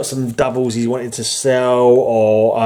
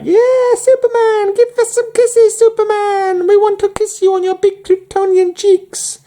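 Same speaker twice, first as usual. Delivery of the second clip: squealing, very high falsetto, louder; hammed-up adoring fangirls; swooning lady fans; 5 dB louder than usual. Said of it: "all these ladies are like".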